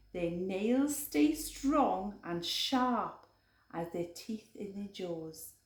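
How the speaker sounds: ordinary delivery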